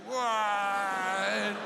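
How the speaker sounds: long drawn-out "wah"; stretched mock action-film cry; slow-motion dodge cry. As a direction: imitate a Matrix slow-motion yell. Mimes dodging bottles like the Matrix.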